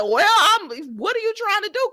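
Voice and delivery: high, loud disbelieving question; loud, high "what are you trying to do?"; incredulous. Retold or imitated. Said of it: "I said".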